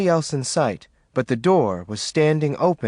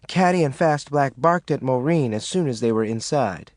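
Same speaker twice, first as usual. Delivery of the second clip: ordinary delivery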